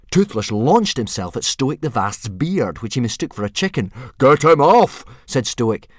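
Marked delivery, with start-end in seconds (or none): 4.2-5.0 loud, raised bellow; alarmed shout. A narrator's reading. Father with dragon on his beard, loud shout. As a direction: bellow it for help.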